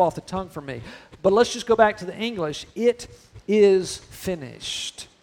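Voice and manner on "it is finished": drawn out, heavy on is; slow it right down and let it ring; slow solemn declaration. Acted. Preacher returning to the English, slow and solemn.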